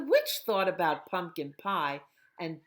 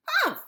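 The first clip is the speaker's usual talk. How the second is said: high, loud scornful humph; witch humph; 9 dB louder than usual. Acted. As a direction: snort it like an offended witch. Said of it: "said the witch".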